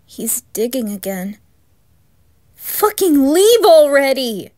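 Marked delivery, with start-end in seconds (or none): 2.5-4.5 loud, frantic yell; desperate shout to drive it away; furious scream. Acted. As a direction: scream it at the thing outside. Yelling at intruder outside.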